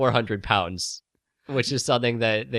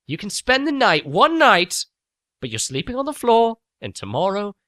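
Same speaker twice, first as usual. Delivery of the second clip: higher, gruff grudging voice; grudging Rey; 9 semitones higher, 5 dB louder than usual. Acted. Rey grudgingly telling the droid it can stay. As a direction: grudgingly lay down the rules.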